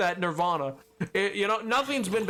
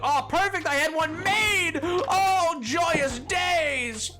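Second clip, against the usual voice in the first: excited; 10 semitones higher, slower than usual. Retold or imitated. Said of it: "It was like".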